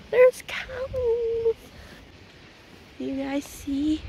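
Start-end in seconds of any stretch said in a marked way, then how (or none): 0.0-1.5 high gasp at the cows; gasp, then exclaim; surprised. Real, not acted. Gasps at cows.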